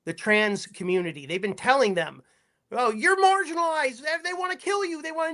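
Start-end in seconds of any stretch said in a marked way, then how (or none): 2.7-5.3 high-pitched mocking alarm. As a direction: parrot their warnings in a higher voice.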